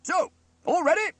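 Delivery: very high, loud officer's question; commanding. A narrator's reading. Steele checks his band, commanding. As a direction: call the band to readiness.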